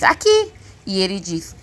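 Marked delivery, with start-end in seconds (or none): 0.0-0.5 put-on very high, rising child call; boy calling out. A narrator's reading. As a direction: pipe up high like a hidden kid. Invisible boy calls out. (voz bem aguda de menino)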